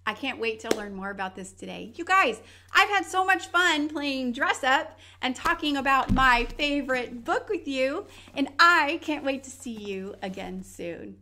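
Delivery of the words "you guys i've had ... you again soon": excited goodbye. Real, not acted. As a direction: wave them off with big energy. Host's enthusiastic goodbye to kids.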